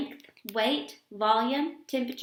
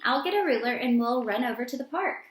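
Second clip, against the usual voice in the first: plain reading voice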